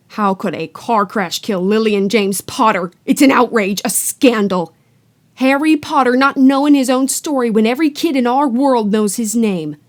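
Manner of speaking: loud, furious giant; outraged giant. Acted. Hagrid furious at the Dursleys, loud and outraged. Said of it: "jumping up so angrily".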